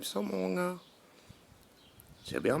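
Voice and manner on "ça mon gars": high squeaky voice. Acted. Voiced-up catchphrase.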